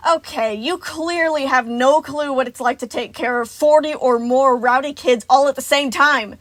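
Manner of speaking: loud exasperated rant, building; building frantic bursts. A narrator's reading. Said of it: "voice building in frantic bursts".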